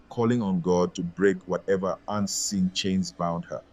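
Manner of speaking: ordinary delivery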